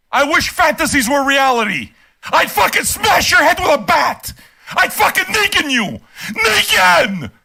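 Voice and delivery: high, angry shouting; furious rant. Real, not acted. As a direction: yell in rage at the commenter. Ranting furiously at the commenter.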